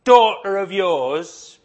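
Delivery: high, drawn-out sneer at this daughter; scornful parent. Retold or imitated. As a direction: spit out daughter of yours in a slow, mocking rise. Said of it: "when you argue about your kids, you say".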